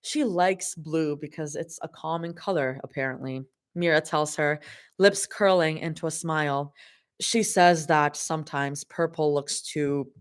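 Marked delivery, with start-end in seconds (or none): none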